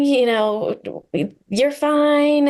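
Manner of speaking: mock parent snapping at a crying kid; impatient parent. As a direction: scold a crying child like a fed-up parent. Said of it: "it's like".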